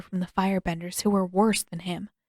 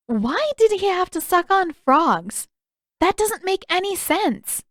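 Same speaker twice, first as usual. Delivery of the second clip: incredulous; 10 semitones higher, 5 dB louder than usual. Acted. Katara hearing about frog medicine, high incredulous. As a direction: stretch out the disbelief.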